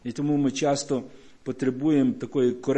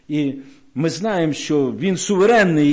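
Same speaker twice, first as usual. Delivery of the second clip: ordinary talk, nothing marked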